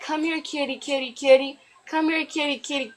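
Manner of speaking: high, soft kitty-calling lilt; catcalling. Retold or imitated. Man catcalling a woman at the gas station, mock catcalling. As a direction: call her over like coaxing a cat. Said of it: "The dude is catcalling her".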